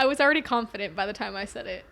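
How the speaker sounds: fast words through laughter; laughing. Real, not acted. Laughing defense.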